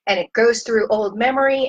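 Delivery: normal talking voice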